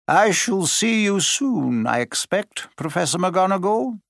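a hint of a calm headmaster; kindly old headmaster. Dumbledore bids farewell.

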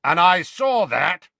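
loud, high, slow rebuke; irritable. A narrator's reading. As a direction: snap back crossly, dragging the words. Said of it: "he added irritably".

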